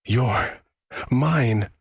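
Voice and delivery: high-pitched, emphatic claim of ownership; possessive claim. A narrator's reading. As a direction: stake the claim, each word separated by a pause. Captor claims her, eyes darkening.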